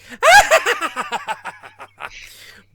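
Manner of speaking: high, loud manic cackle; imitated giddy supervillain cackle; funny Joker laugh. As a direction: explode into zany clown laughter. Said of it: "if the Joker's supposed to be funny".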